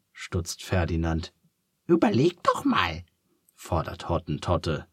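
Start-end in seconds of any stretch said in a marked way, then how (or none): 1.8-3.0 very high, squeaky little duck, curious; a cheerful duck asking kindly. A narrator's reading. Duck urges.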